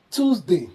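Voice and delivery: "Tuesday" as tooz-day, American way. Shown as a demonstration. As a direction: say "Tuesday" with a plain t and oo. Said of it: "In American".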